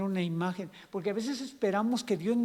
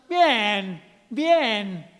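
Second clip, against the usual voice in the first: loud, drawn-out, punched-out "fine"; emphatic put-on "I'm fine"; insistent "fine"; 7 dB louder than usual. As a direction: belt it out as a brave front. Acted answer to how are you.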